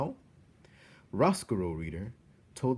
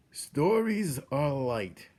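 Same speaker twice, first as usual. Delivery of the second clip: put-on slow, higher gentle old man's voice; old jailer Gregory; 5 semitones higher, 4 dB louder, much slower than usual. A narrator's reading. Jailer telling Despereaux in the dungeon, slow gentle wisdom. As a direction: say it slowly, like a precious truth. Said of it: "Gregory the jailer told Despereaux".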